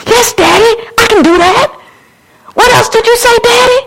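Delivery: put-on high, eager, delighted child; acted high-pitched, growing wonder; child answering Daddy. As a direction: respond to Daddy with rising excitement. Acts a child answering God.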